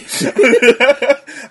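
loud, high burst of laughter; laughing. Real, not acted. Laughing at the tasteful pimp idea, loud high laugh. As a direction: burst out laughing at full volume.

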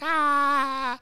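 high, sustained mock wail; long high-pitched fake cry; imitated crying wail. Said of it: "is, like, crying".

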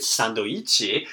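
sandoichi said slowly, Japanese syllable by syllable; katakana sandoichi. Shown as a demonstration. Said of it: "examples like sandwich".

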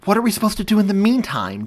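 high, loud demand; heated, pressing question. A narrator's reading. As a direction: demand an answer urgently. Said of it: "Joey demanded".

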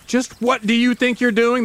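mock-angry rich homeowner; hot and fast. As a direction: demand an answer from the trespasser. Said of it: "His words, they come hot and fast".

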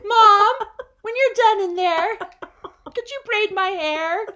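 whiny mock kid, high and loud; child calling Mom. Kid pestering crying mom through bathroom door, high mock-child whine. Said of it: "I just would gently knock on the bathroom door".

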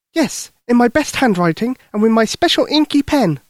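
put-on high, eager young girl; little girl voice. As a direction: speak like a worried little girl. Lucy speaks.

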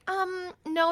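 high, drawn-out mock reluctance; hesitant teen refusal. Daughter acting out teens answering mom's shopping pick, reluctant high refusal.

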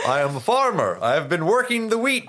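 mock-declaiming farmer, rising high; self-important farmer. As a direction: proclaim your labor like an indignant farmer.

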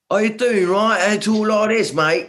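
a jokey bad English accent; mock English accent. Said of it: "I'm not gonna do the accent".

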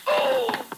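sharp high grunt of effort; a jolt of effort in the scuffle; struggle grunt. Acted. Detective grunts grabbing the fleeing woman, sharp and high.

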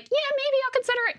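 high, fast, breezy noncommittal voter; mock voter. Mocking a voter who politely brushes off a candidate, high and airy. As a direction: toss it off lightly, airy and high. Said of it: "the one that's like".

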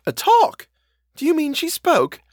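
very high, amazed questions; stupefied. A narrator's reading. Housekeeper hears Miss Jessel talked, high-pitched amazement. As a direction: burst out high and loud in disbelief. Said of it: "the candor of her stupefaction".

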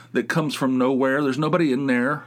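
ordinary talk

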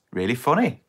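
usual speaking voice

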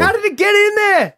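very high squealed question; horrified disbelief. Real, not acted. Listener demands an explanation of the chili, horrified squeal.

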